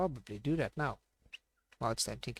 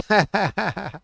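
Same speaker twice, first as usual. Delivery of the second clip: high, loud laugh; sudden loud laughing outburst; loud burst of laughter; 7 semitones higher, 14 dB louder than usual. Real, not acted. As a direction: crack up at the chat comment. Reacting to Irish accent comment.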